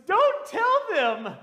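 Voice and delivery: very loud, high, slow excited plea; retold laughing-crying outburst. Granddaughter to pastor after the death, loud giddy plea. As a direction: imitate her giddy plea at full volume. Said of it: "she's laughing".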